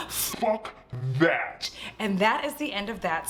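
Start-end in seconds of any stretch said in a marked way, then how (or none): 0.1-1.7 mock demon, breaking from voiceless to low; demon Lamia. Lamia refusing to return the soul, mock-demonic refusal. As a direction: spit out a drawn-out creature refusal. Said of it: "the Lamia's like".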